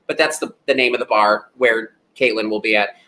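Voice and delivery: usual speaking voice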